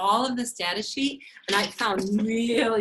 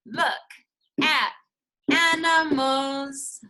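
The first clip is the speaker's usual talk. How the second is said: slow, drawn-out "animals", louder at the end; slow sign reading; 4 semitones higher, 5 dB louder, slower than usual. Shown as a demonstration. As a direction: read the sign word by word, stretching "animals". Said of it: "What does it say?".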